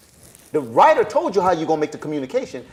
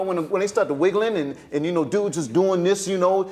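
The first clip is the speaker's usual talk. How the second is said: ordinary talk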